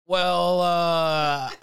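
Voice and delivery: loud, drawn-out hesitant admission; sheepish stalling. Retold or imitated. Friend retelling a man admitting he has drugs, sheepish stalling. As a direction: stall guiltily, stretching out each word.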